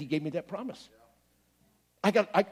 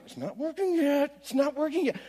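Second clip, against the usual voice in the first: inner voice; 9 semitones higher than usual. Retold or imitated. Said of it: "deep down inside, it's going".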